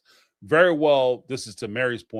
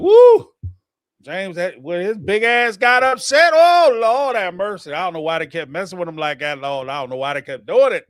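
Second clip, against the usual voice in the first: hyped gossip; 6 semitones higher, 7 dB louder than usual. Retold or imitated. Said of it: "They'd be like".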